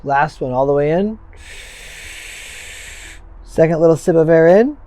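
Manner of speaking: calm, firm breathing coach; guided breathwork cues. Acted. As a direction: count them through each breath.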